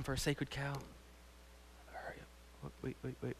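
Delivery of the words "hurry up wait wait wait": whispered aside to himself; quick whispered aside; hurried whisper. Real, not acted. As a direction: hiss it under your breath to rush. Rushing himself for time.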